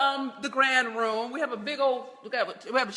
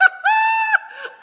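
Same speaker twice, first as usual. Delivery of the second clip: loud, gleeful burst of laughter; delighted laugh; 7 dB louder than usual. Real, not acted. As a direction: squeal with delight at the pick. Showing off her fist pick.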